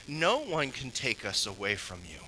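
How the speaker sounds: ordinary delivery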